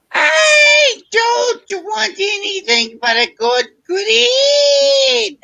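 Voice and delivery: put-on very high, loud, squealing teen voice; mock adolescent shriek, stretched out; pre-pubescent teen. Shown as a demonstration. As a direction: cry it out like a whiny pre-teen, far up high. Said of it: "pre-pubescent teen".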